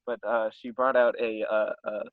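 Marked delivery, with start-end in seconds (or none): none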